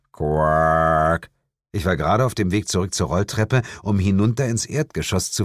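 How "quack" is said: low, drawn-out croaked quack; frog quack. Acted. The frog answers the calling woman with a croak.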